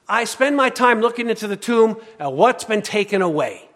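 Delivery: preacher building to a loud climax; full-force preaching. Acted. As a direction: hammer the point home.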